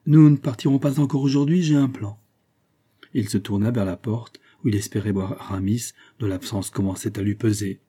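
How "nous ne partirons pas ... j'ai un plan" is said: higher and brighter, a touch of cheer; mild cheerful announcement; with gaiety. A narrator's reading. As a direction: lift the line with sudden good humour. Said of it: "avec une sorte de gaieté".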